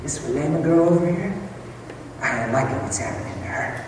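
low, hushed conspiratorial devil; quiet, quick menacing instructions to a henchman; scheming devil. Acted. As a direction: give sinister orders under your breath. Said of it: "So the devil says".

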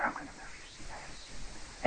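very soft imitated whisper; hushed flight attendant aside; discreet whisper. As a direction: whisper it confidentially to a passenger. Flight attendant discreetly offers another seat.

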